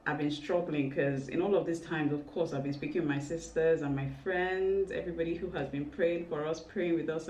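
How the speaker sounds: ordinary talk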